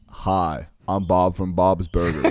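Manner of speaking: lightly voiced low character, unhurried; Family Guy shop owner. Host shows off a cartoon impression, low slow voice. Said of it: "I can do his voice really well".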